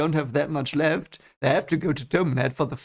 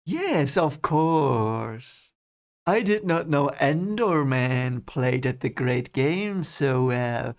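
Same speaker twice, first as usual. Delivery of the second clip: smooth count, stretching the words out; Barthanes; much slower than usual. Acted. Barthanes sparring with Rand, slow put-on count's voice. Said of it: "that's my Barthanes impression now".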